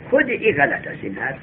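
ordinary delivery

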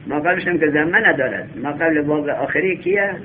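ordinary talk, nothing marked

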